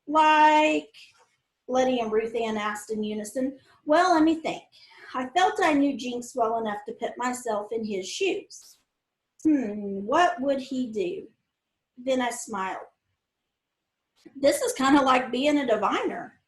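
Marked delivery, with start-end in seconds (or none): none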